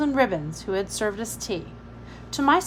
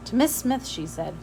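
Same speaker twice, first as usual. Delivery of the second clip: ordinary delivery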